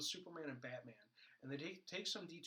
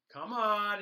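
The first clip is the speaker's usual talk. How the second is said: mock exasperated Flash; 6 semitones higher, 12 dB louder than usual. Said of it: "He goes".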